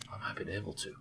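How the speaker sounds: soft murmured whisper. Real, not acted. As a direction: whisper it to yourself.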